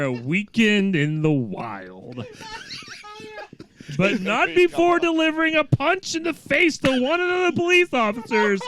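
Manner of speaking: loud, high news anchor voice; dramatic news read. Acted. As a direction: read the headline like a breathless anchor. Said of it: "It was the delivery".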